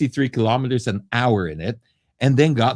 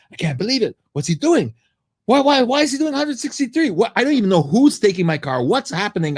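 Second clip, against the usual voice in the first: high, frantic outburst of retold disbelief; replaying the rage while scrolling through messages; enraged disbelief; 12 semitones higher than usual. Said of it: "and I'm getting enraged".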